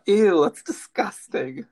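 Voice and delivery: disgusted whisper. Real, not acted. Disgusted at chewing close-up.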